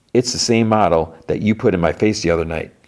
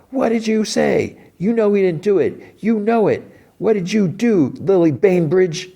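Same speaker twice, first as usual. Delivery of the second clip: calling out; 14 semitones higher than usual. Retold or imitated. Ronnie calling from the street to Lily, high accusing shout. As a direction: call up to the house, much higher. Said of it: "she calls out".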